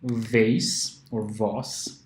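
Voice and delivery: very slow "vase or vase", both ways; two ways of vase. Shown as a demonstration. Teacher slowly gives two pronunciations of vase. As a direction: spell out both versions slowly. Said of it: "for example".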